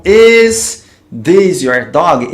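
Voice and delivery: devagar, cada palavra bem separada; pergunta lenta de professor. Shown as a demonstration. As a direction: dite a pergunta bem devagar pro aluno. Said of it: "eu tô fazendo uma pergunta". (lendo devagar a frase em inglês)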